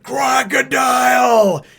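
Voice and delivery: long, high, slow battle cry; Luffy shouting. Retold or imitated. Luffy calling out his enemy with a long, high shout. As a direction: hurl the name across the battlefield. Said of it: "just kinda like".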